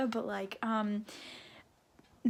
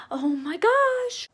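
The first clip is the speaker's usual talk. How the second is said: much higher, drawn-out gasp of shock; 11 semitones higher, 6 dB louder, slower than usual. Real, not acted. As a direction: let the shock spill out slowly. Shocked by the plot.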